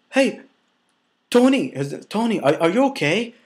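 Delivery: high, anxious call of a name; calling out to a friend who looks unwell; retold worried cry, pitched high. Calling to a collapsing driver.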